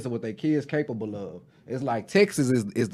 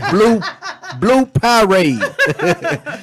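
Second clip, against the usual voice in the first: "blue Powerade" dragged out very slowly, higher and louder through laughter; slower and slower; 9 semitones higher, 7 dB louder, much slower than usual. Shown as a demonstration. Laughing while over-pronouncing the drink order at the drive-through window. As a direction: stretch every sound of "blue Powerade" as if to a child. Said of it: "say it slow and slower".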